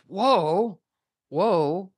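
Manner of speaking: high, rising "whoa"s; acted amazement. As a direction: marvel with a pause between whoas. Gawain sees the beautiful woman.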